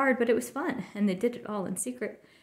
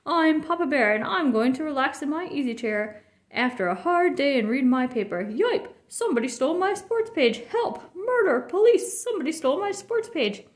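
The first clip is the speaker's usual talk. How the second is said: mock Papa Bear; 3 semitones higher than usual. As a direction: overact the outraged father. Cub playing Papa in skit.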